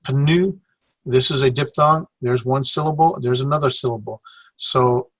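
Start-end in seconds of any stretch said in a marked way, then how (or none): 0.1-0.6 "pnu" with the P sounded, puh-NOO; pronounced P. Shown as a demonstration. Teacher showing what some people do, "pnu" as puh-NOO. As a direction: pop the P in "pnu" before noo. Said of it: "will put the P and make".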